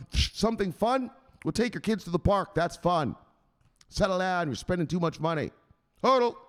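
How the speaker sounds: high, incredulous telling-off; scolding enforcer. Acted. Enforcer scolding the victim, high hectoring. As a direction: throw the words back, then yelp the order. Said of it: "That's what he does".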